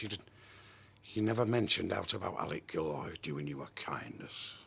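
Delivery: low, soft, hissed accusation; accusing whisper. Acted. Husband to wife over Alec Gilroy, bitter whisper. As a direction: breathe it out bitterly.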